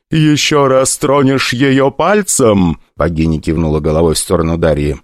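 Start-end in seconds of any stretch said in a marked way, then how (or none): 0.1-2.8 menacing goddess. Acted. Goddess threatens the rich kid.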